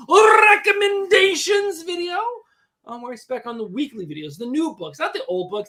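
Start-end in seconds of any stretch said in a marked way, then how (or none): 0.1-2.2 announcer shout. Acted. Shouted show title.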